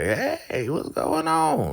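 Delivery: high, drawn-out delighted greeting; delighted grandma. Retold or imitated. Grandma thrilled to hear [name] at the door, high and slow. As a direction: stretch it way up like a thrilled granny.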